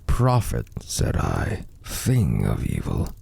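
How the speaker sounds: ordinary delivery, nothing marked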